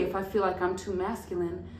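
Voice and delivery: normal talking voice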